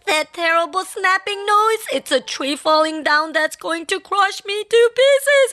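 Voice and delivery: high, frantic cries of terror; shrieking panic. A narrator's reading. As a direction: panic wildly, one disaster after another. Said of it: "he shrieked".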